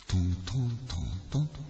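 low, soft tapping; a cat padding in behind the mother; soft pattering steps. Retold or imitated. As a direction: tap out little paw steps quietly. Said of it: "マーが入ってきた".

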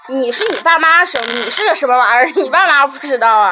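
loud, emphatic teasing scold; scoffing. Real, not acted. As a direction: scoff loudly at a friend's naivety. Mock scolding a friend's naive belief.

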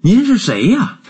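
high-pitched cry of surprise; startled exclamation. A narrator's reading. Fred hears his uncle call, startled high exclamation. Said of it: "弗雷德大叫起来".

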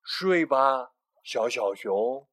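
loud, slow fatherly bear; Big Bear voice. A narrator's reading. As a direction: soothe the little bear to sleep. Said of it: "说：".

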